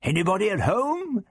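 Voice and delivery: high, cheery calling out; cheerful call. A narrator's reading. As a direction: call into the house merrily. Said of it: "the Doctor called cheerfully".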